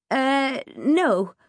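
hesitant, unconvincing denial; hesitate, then deny it; lying. A narrator's reading. Girl lying to spare her friend, hesitantly. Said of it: "I lied".

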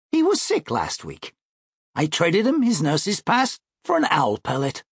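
a hint of a self-assured boy; confident schoolboy brother. Brother explaining his nurse's pass, lightly voiced confident schoolboy.

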